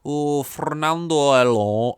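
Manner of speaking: put-on drawn-out northern English accent with a Spanish twist; northern English Spanish mix. Podcaster hams up a driver's name in a northern-Spanish accent. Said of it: "a weird mix of a northern English accent with, like, a little bit of Spanish".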